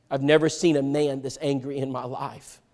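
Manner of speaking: ordinary delivery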